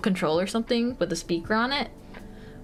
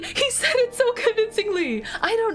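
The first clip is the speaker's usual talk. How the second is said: excited; 12 semitones higher than usual. Real, not acted. Excited over chef.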